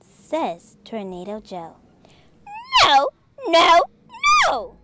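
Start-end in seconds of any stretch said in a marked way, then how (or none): 2.8-4.8 loud, high crying shout; tantrum scream. Acted. Tornado Joe screaming in a tantrum, crying shout. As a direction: throw a full tantrum. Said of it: "tantrum scene".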